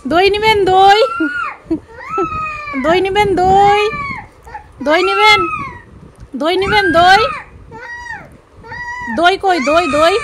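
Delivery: loud sing-song street seller call; hawker call. Pretend. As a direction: cry out your yogurt like a vendor. Playing a yogurt hawker. (দই বিক্রেতার মতো জোরে হাঁক)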